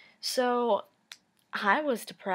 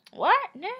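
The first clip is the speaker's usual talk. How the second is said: mock high-pitched snapping, loud; girly snapping; 11 semitones higher, 7 dB louder than usual. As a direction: imitate a touchy boyfriend snapping like a little girl. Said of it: "like, snapping like a little fucking girl".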